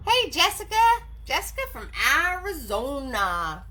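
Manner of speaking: high, bright hello; excited greeting. Real, not acted. Greeting a new viewer by name, excitedly.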